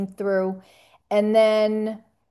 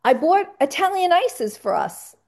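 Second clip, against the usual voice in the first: excited retelling; 7 semitones higher than usual. Retold or imitated. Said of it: "He was like".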